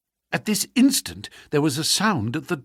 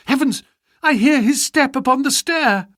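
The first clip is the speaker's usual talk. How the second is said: sudden alarm; 9 semitones higher, 6 dB louder than usual. Acted. She hears her husband coming.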